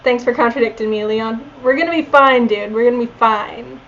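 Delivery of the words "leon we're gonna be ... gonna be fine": normal talking voice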